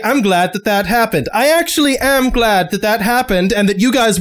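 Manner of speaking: exasperated small-town lawman dressing them down; frustrated sheriff. Acted. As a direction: start with mock praise, then lecture them.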